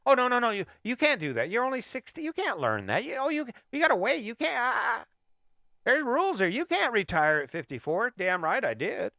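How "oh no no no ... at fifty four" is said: high, whiny scolding of a naysayer; mocking naysayers. Host mocks people who say you're too old, high and whiny. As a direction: mimic the voice that says you must wait.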